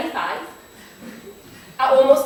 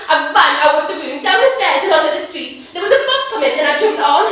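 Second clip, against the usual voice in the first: loud, raised, breakneck narration; frantic escape; 3 semitones higher, 8 dB louder than usual. Acted. Girl flees downstairs to the bus, loud and frantic. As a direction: keep up the panic of running away.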